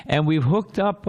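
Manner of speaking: usual speaking voice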